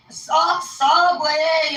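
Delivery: high, long drawn-out "Subway"; imitated mishearing. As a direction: mimic a puzzled listener stretching "Subway".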